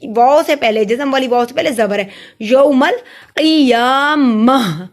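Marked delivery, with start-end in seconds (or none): 2.4-4.9 measured, drawn-out यौमल क़ियामह; pausal recitation. Shown as a demonstration. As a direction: stretch क़ियामह and stop on a breathed ह. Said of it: "क़ियामह इसलिए पढ़ा".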